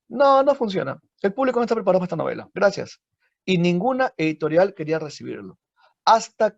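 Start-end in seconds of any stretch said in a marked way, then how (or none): 0.1-3.0 dismissive publisher. Acted. Publisher rejecting the novel.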